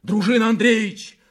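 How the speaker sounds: high, loud urgent shout; urgent warning call; calling from below. A narrator's reading. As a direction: shout the name up from downstairs. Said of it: "раздался голос снизу".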